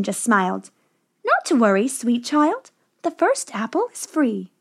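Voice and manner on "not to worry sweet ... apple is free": put-on higher, coaxing old woman voice; disguised witch, sweetly tempting. A narrator's reading. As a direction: lure her in gently. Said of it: "the woman said".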